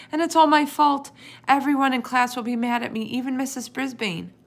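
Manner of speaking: put-on high, loud child's lament; howl. A narrator's reading. Sophie blames herself before her parents, howling. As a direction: keep howling, easing off at the end. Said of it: "Sophie howled".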